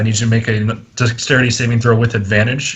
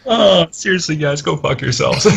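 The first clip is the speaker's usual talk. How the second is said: mock exasperation; 6 semitones higher than usual. Mock offence at players' teasing.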